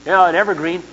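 normal talking voice

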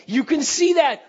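loud, high preaching shout; emphatic shout. Real, not acted. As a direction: drive it home to the crowd. Preacher urging husbands to notice.

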